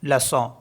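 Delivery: लसॉ ending in a nasal ॉ; French leçon. Shown as a demonstration. Teacher repeating French word leçon. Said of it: "इसको पढ़ते हैं लसॉ".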